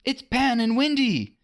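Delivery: high, excited cry; boys cheering. A narrator's reading. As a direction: shout with joy. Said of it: "the boys cried".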